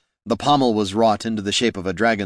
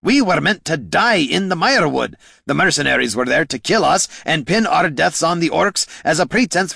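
loud, higher bitter tirade; spat bitterly; 8 semitones higher, 4 dB louder than usual. Acted. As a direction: spit the words out in disgust. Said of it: "spat Gorm".

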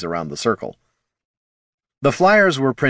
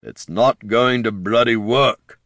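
put-on slow, grumbling old ghoul; gruff ghoul baron; 2 semitones higher than usual. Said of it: "said Lord Hulch".

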